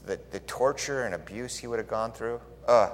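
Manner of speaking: ordinary talk, nothing marked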